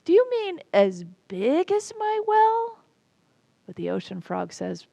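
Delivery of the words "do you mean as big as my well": squeaky, much higher disbelieving cry; can't believe his ears; incredulous. Acted. Little well frog demanding of the ocean frog in high incredulous squeak. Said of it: "the little well frog gets incredulous and says".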